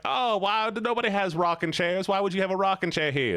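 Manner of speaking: high-pitched mock complaint; high put-on voice of indignant questioning; mock complainer. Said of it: "guy's like".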